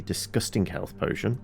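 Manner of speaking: normal talking voice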